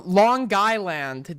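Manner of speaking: "Long Island" stretched out very slowly in a New York accent; "Long Island" as a long, drawn-out local pronunciation. Shown as a demonstration. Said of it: "That's how you say it".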